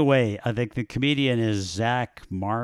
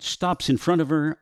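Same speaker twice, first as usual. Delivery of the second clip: ordinary talk, nothing marked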